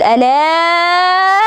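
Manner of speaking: name drawn out slowly and high; distant voice calling. Retold or imitated. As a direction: call her name from far off as she wakes. Someone calls her name. (صوت بعيد ينادي اسمها ببطء)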